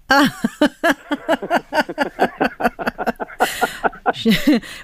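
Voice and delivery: a long, high, hearty laugh; long burst of laughter. Real, not acted. Father recalling his daughter's baldy joke, long hearty laugh.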